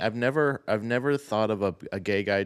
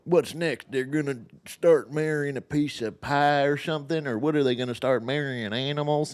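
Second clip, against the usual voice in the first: higher, indignant conservative voice; mocking a conservative critic, in a higher indignant tone; mock outraged conservative; 4 semitones higher than usual. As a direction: ham up the slippery-slope panic. Mocking the other side.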